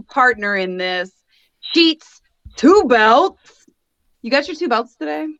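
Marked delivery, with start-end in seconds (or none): 1.7-3.6 drawn-out ring announcer intro. Acted. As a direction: stretch the name like a ring announcer. Announces partner's wrestling name.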